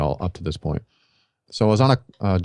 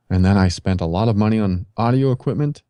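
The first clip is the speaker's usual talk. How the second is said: ordinary delivery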